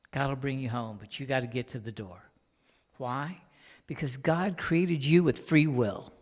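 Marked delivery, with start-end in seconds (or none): none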